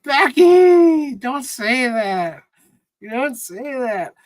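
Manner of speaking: high-pitched protest trailing down; pleading dismay. Real, not acted. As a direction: cry out the name, then plead. Reacts to viewer's lonely comment.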